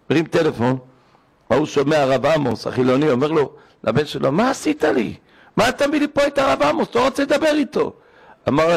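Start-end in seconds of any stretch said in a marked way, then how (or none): 4.3-7.9 imitating angry father.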